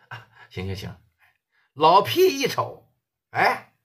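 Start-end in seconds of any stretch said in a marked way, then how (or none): none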